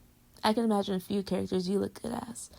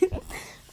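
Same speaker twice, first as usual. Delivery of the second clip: breathy giggle; 6 dB quieter than usual. Real, not acted.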